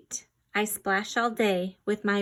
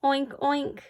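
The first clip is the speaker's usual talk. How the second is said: playful oink oink imitation; mock piggy oinking; 5 semitones higher than usual. Shown as a demonstration. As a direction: make the pig noise for kids. Animal sound quiz for kids.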